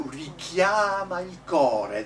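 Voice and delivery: slow "richiama il cuore", leaning on CHIA and CO; stress on CHIA, CO. Shown as a demonstration. Coach showing singers where the accents are, stress on CHIA and CO. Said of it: "The accents are on chia and co".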